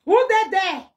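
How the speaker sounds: high loud mocking call; shouted mock question. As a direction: yell it out like a startled caller.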